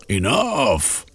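firm call to stop; mild cry. Acted. Lord Blackanor stops the quarrel, firm call. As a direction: cut them off sharply. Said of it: "Lord Blackanor cried".

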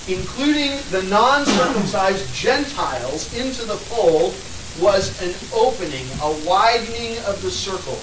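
loud, rising sermon oratory; impassioned preaching. Real, not acted. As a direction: preach it hard to the room. Preaching at full force.

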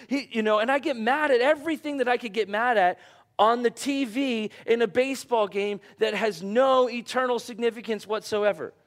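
ordinary talk, nothing marked

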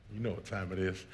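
dropped to a low, quiet murmur; hushed hint. Retold or imitated. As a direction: murmur it low and suggestive, soft. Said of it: "I told her, I said".

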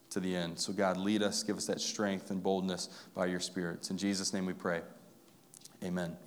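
pastoral prayer. Acted. Pastor praying for his congregation before the sermon, soft and earnest. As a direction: pray humbly on behalf of the room.

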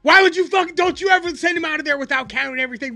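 high, loud, fast yelling; retold yelling. Boss yelling at the coworker, high fast yelling. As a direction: tear into him. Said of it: "yelled at that dude".

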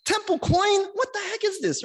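shrill-free high outraged cry; outraged high exclaim. Retold or imitated. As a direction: act out outraged disbelief. Jesus voiced, outraged at coins.

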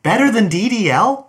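can't believe what you just heard; high, loud incredulous question. Real, not acted. Incredulous at Oldman beating DDL.

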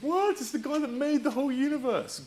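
high, eager praise of the answer; excited. Real, not acted. Excited reply to a child's answer.

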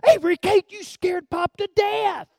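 high, loud retold yell; hammed-up shocked grandpa exclamation; startled outcry. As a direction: cry out at the child who frightened you.

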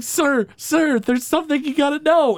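put-on a young aide rushing to warn his boss; urgent Senate page.

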